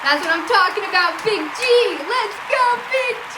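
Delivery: loud, high-pitched hype; excited cheering. Real, not acted. Host cheering on Big G after the clip, excited shouting. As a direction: cheer her on at full volume.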